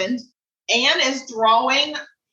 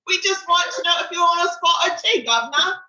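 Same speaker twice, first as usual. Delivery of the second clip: higher-pitched mock Cockney; Cockney, pitched up and hammed-up; Cockney accent; 6 semitones higher than usual. Shown as a demonstration. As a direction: put on a playful Cockney accent. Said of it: "Oh, Cockney, you're gonna do Cockney".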